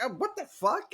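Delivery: pained shock. Retold or imitated. Billy bitten by the Mogwai, high shocked yelp. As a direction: yelp like a finger just got nipped.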